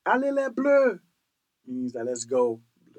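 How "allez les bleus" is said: loud, high French football chant; World Cup fan cheer. Acted. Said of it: "you're gonna hear them say".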